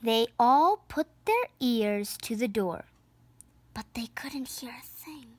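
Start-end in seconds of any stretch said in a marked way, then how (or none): 3.8-5.3 whisper. A narrator's reading. Ears pressed to silent door.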